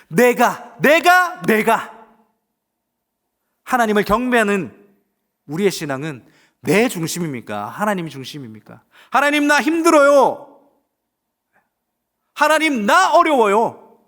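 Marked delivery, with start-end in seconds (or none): 0.1-2.0 내가 repeated, the middle one much higher and louder; mocking stress on 내가. Mocks self-centred faith. 9.1-14.0 high, loud complaint, slow at the end; whining prayer. Acted. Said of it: "그러면서 내려놓지는 않습니까".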